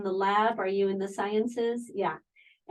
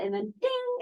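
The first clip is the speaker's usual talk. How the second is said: mock ding. Mock quote of instant balance.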